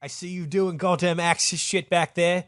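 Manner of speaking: high, loud British bluster; pompous British ambassador telling someone off; Jeremy Clarkson. Acted. Ambassador firing Farouk from being king, blustering British voice. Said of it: "our fucking Jeremy Clarkson".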